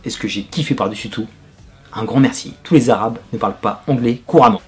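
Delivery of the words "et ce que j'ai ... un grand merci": ordinary delivery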